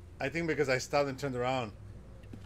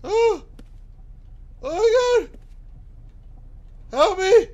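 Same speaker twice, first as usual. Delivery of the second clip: very high, loud shrieks of fright; terrified scream; 9 dB louder, slower than usual. Real, not acted. Player jump-scared in a horror game, terrified scream. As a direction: scream for help, high and full out.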